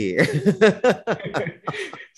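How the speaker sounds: high, helpless giggling; real laugh. Real, not acted. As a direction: keep laughing at the joke. Amused by broccoli mispronunciation. (หัวเราะขำเสียงสูง)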